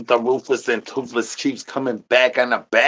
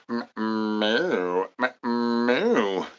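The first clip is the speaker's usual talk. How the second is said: cow moo; 7 dB quieter, much slower than usual. Pretend. Mooing like a cow.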